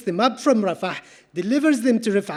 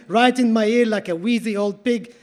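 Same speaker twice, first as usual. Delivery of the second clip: usual speaking voice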